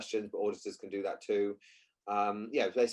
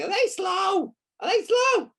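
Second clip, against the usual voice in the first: high, loud shout in a put-on American accent; mock American accent; 8 dB louder, slower than usual. Coach imitating the handler calling from far up the field, mock American shout. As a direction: holler it like a badly faked North American. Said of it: "You're American now, by the way, or Canadian".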